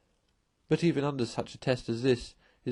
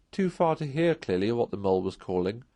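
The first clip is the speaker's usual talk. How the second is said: plain reading voice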